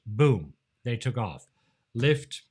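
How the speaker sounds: ordinary delivery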